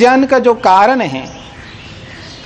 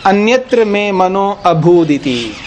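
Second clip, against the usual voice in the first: slow Sanskrit recitation. Shown as a demonstration. Sanskrit quote recited slowly.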